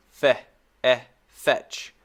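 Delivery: Fe, eh, then fetch, built up slowly; fetch broken into sounds. Shown as a demonstration. Teacher breaking the word into sounds. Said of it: "breaking fetch into sounds".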